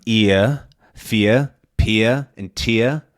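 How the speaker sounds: "ear, fear, pia, and tia" ending in uh, no R. Shown as a demonstration. Teacher drilling New York sounds, R dropped to uh. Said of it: "which becomes really the similar".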